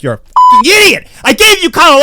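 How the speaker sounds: loud, high scolding shout; furious father. Retold or imitated. Dad berating his son after overthinking, furious shout. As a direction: yell it as an exasperated dad.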